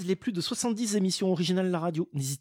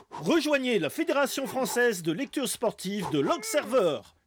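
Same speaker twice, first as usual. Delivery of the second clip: higher, emphatic ad pitch; ad announcer voice; 4 semitones higher than usual. Acted. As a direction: sell it like a TV ad. Fake sports federation ad.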